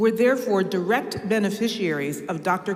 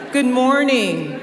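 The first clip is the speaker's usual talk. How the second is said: louder, higher, drawn-out good morning; energized greeting; 5 semitones higher, 6 dB louder, slower than usual. Real, not acted. Speaker getting the audience's energy flowing, loud energized call. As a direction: rouse a sleepy crowd with a big hello. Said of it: "need to get our energy flowing".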